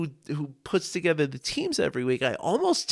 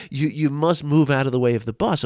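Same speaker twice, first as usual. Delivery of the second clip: usual speaking voice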